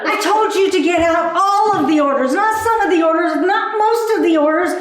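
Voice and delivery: hammed-up furious boss; angry boss rant. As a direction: tear into the staff. Ranting at workers about orders.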